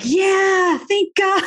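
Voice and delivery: high, gleeful mock cheer from the husband; imitating excited husband. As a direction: play him overjoyed at the news. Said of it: "He was all excited".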